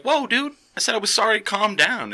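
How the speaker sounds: high-pitched retold protest; acted startled placating. Apologizing to an angry guy in the crowd, high-pitched protest. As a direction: back off with your voice shooting up.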